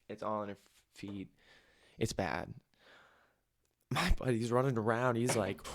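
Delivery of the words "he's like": ordinary talk, nothing marked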